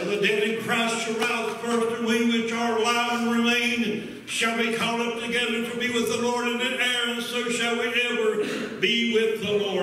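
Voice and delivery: high, driving scripture proclamation; full-force preaching. Real, not acted.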